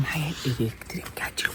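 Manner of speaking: whisper. Real, not acted. Bragging about his taser.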